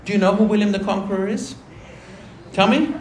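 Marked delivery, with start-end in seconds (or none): none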